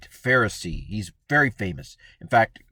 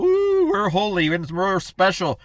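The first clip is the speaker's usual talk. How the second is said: slow, higher, put-on piety; a hammed-up holier-than-thou drawl; mock holy voice; 7 semitones higher, 3 dB louder than usual. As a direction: preen like a self-satisfied saint. Mocking couple's pious show.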